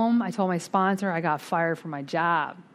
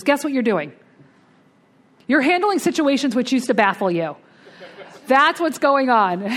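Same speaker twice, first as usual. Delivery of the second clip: loud, raised imitation of sponsor's revelation; emphatic announcement; 5 semitones higher, 5 dB louder than usual. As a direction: proclaim it loudly, pitched up. Sponsor's triumphant realization acted out.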